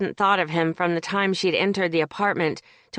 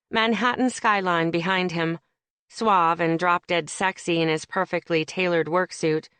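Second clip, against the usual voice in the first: plain reading voice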